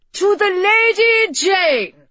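high, loud cheer of a toast; group toast. A narrator's reading. Guests answer the groom's toast, loud rousing cheer. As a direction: call it out like a hall full of guests. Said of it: "they said in unison".

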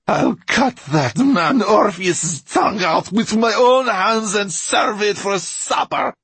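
high, loud, vengeful screech; furious exclamation. A narrator's reading. Mortola threatening absent Orpheus over the ruins, furious screech. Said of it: "she exclaimed".

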